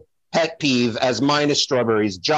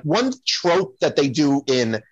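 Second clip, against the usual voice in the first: ordinary delivery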